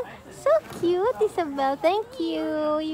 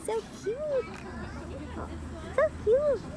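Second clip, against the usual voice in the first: cooing; 3 semitones higher, 8 dB quieter than usual. Real, not acted. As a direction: coo over the little ones. Cooing over babies.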